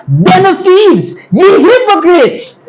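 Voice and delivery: high, slow shouted rebuke; angry rebuke. Shown as a demonstration. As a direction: thunder it at the money changers. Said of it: "Dapat gali".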